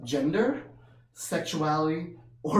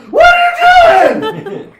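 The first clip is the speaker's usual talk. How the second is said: shocked shout; 18 dB louder, slower than usual. Retold or imitated. Shouting at kid who pinched.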